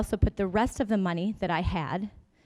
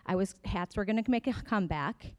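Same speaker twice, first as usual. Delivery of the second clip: ordinary talk, nothing marked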